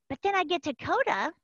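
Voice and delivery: ordinary talk, nothing marked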